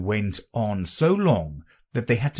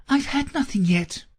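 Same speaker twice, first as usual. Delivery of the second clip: high, louder indignant reply; offended; 5 dB louder than usual. A narrator's reading. Alice answers the March Hare's offer, offended. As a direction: protest huffily in a high little voice. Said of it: "in an offended tone".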